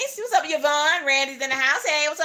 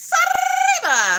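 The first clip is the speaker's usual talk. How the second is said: much higher, loud, stretched-out name call; loud drawn-out happy greeting; excited shout-out; 6 dB louder, much slower than usual. Real, not acted. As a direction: call her name out slowly in delight. Greeting a viewer in chat.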